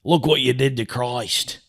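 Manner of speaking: lightly put-on Aussie accent; mock Australian accent. Host quoting Gibson to test if he's Australian, mock Australian accent. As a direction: quote it in a rough Australian imitation. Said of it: "was he Australian?".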